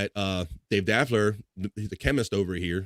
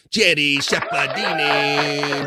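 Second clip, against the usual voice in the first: drawn-out, higher name call; hyped-up name announcement; 5 semitones higher, 4 dB louder, much slower than usual. Real, not acted. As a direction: stretch the name out like a guest introduction. Host announces arriving viewer by name.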